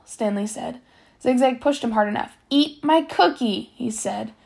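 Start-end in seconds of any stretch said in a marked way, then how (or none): 2.5-3.7 "Eat my cookie" slow and higher, word by word; slow, deliberate "Eat my cookie"; menacing order. A narrator's reading. Bully's final order while pushing.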